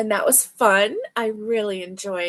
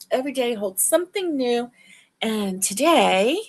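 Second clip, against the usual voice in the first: ordinary talk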